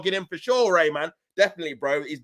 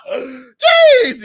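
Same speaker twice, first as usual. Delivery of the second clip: very high, loud drawn-out exclamation; loud, high-pitched whoop of amazement; amazed shout; 12 dB louder than usual. Real, not acted. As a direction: yelp it out in disbelief. Exclaims after laughing fit.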